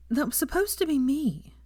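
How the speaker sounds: high, helpless and tearful. A narrator's reading. Hermione confessing her hurt to Draco, helpless. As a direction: let it fall apart helplessly. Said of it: "she finished helplessly".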